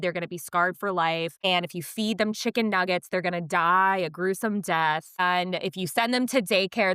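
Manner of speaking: ordinary talk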